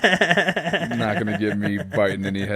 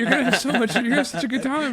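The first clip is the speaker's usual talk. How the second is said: laughing tease; much faster than usual. Real, not acted. Teasing friend about cold water.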